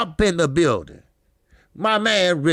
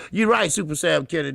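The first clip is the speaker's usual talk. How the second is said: usual speaking voice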